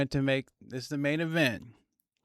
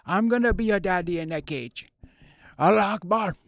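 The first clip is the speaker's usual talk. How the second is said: loud, high mock Chechen trash talk; hammed-up loud taunt, higher than usual; mocking Khamzat; 9 semitones higher, 9 dB louder than usual. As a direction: bark it like a taunting fighter. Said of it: "Khamzat's been talking about his daddy, saying".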